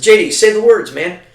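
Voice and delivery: loud, high urging; urging loudly. Retold or imitated. Urging his friend on speakerphone to say the words, loud. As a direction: push him to say it now.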